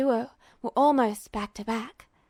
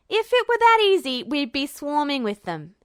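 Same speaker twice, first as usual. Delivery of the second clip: raised retort; 2 semitones higher, 4 dB louder than usual. Acted. Edward interrupting, arguing.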